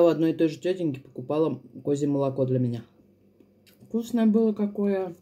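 chewing while talking; speaking around food; mouth full. Real, not acted. Seen 2: fork at lips, bites and chews while speaking.

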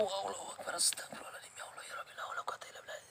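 hushed frightened prayer under the breath; terrified murmured prayer; whispered prayer. Real, not acted. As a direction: pray in a whisper so nothing hears.

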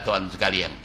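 usual speaking voice

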